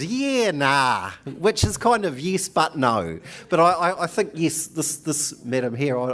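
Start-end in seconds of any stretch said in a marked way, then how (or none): 0.0-1.1 Kiwi phrase demo. Shown as a demonstration. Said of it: "So you're here in New Zealand".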